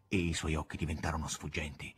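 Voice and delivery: plain reading voice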